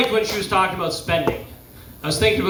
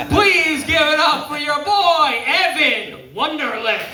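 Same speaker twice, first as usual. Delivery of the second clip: big, slow, higher announcer call; emcee introduction; 7 semitones higher than usual. Real, not acted. Host bringing Evan on stage, drawn-out announcer shout. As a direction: draw out the name to raise applause.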